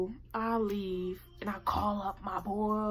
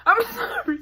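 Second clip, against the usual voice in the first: high, loud laughing outburst; laughing apology; 12 dB louder than usual. Real, not acted. Vlogger apologizing to viewers mid sign-off, laughing loudly. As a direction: squeal it through a laugh. Breaks up during her sign-off.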